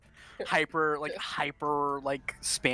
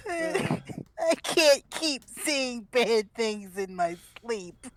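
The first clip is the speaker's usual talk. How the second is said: put-on lost Russian accent; 5 semitones higher, 4 dB louder, much slower than usual. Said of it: "he really lost his Russian accent".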